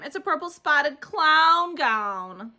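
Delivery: loud, very slow jeer; sing-song taunt. A narrator's reading. Jim taunting Junie's clown gown, loud and slow. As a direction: drag out the mockery.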